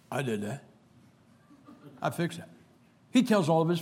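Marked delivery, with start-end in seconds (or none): 0.1-2.5 softer, quietly proud mutter; a husband admiring his own handiwork. Retold or imitated. Preacher imitates a husband admiring his repair, softly proud. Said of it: "He stares at it for an hour".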